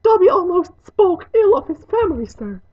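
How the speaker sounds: high, loud elf voice; squeaky servant explaining himself; contrite house elf. Acted.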